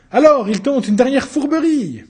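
high, loud indignant denunciation; loud accusation. Acted. Judge denouncing the accused's last trick, loud and high. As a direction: call out the trickery to the court.

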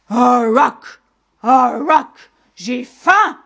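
loud owl-monster screech; monster's shout. A narrator's reading. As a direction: roar your hunger like a monstrous owl. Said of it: "cria de nouveau le monstre". (cri de monstre hibou affamé)

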